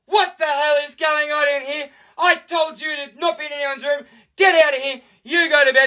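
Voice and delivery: high-pitched scolding mother; furious mum. Retold or imitated. Mum storming in on fighting sons, high angry scolding. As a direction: burst in and order them out. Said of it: "she came running in and she's like".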